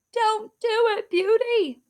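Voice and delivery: high, loud urgent warning. Acted. A voice warning Beauty not to touch the needle, high and urgent.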